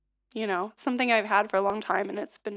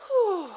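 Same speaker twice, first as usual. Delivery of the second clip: demo sigh out; 8 semitones higher, 4 dB louder than usual. Shown as a demonstration. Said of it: "sigh it out".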